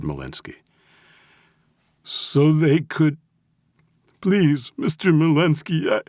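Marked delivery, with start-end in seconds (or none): 2.0-6.0 high, loud, tearful begging; desperate pleading. A narrator's reading. Captive begs mob boss mid-confession, desperate. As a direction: break off and beg him, pitched high.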